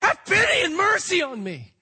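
desperate shouted cry for mercy; shrieking scream. Acted. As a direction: scream it out like a desperate blind beggar. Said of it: "screamed and shrieked so much the more".

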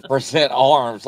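lighter imitation of a drill order; mock military command. Shown as a demonstration. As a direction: replay the command half-joking. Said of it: "Why did I sound like a damn military fool?".

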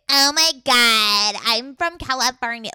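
drawn-out mock Valley girl accent; loud, exaggerated Valley girl accent. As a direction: overdo a Californian Valley girl. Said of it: "like, a Valley girl".

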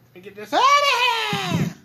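high, slow, shouted line; drawn-out comic shout; sudden shout. Pretend. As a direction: belt it out like a yell. Repeats line as loud shout.

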